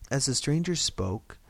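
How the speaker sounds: ordinary delivery, nothing marked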